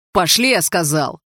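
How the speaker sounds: loud, slow, commanding; barked order. A narrator's reading. Лёха orders the gang to leave, loud and slow.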